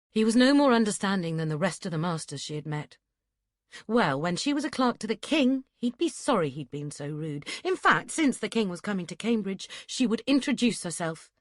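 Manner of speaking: ordinary delivery, nothing marked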